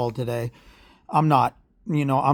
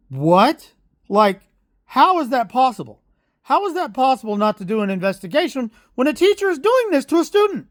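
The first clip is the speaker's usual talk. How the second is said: heated outrage; 10 semitones higher than usual. Retold or imitated. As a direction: pitch climbing high in disbelief, rising heat. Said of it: "got heated".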